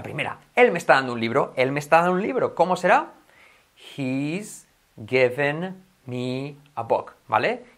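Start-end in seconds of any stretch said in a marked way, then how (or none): 3.9-7.1 very slow, "given" with I near E; letter-focused slow model. Shown as a demonstration. Teacher translates for learners, very slow and separated. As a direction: spell out "He's given me a book" word by word. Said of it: "focalizando primero los".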